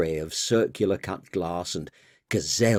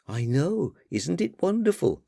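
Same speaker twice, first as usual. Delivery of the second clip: higher, exasperated daughter voice; 8 semitones higher than usual. Acted. Daughter chiding her father about the party, higher voice. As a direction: scold Dad for never listening, higher.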